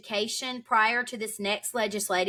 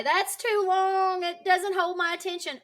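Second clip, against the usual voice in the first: mocking complainers; 8 semitones higher than usual. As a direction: drag out "too" like a bored, whining listener. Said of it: "I hear people say".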